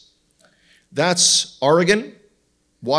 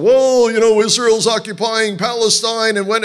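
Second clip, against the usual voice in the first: mocking a stranger; 6 semitones higher, 6 dB louder than usual. Stranger in Walmart arguing.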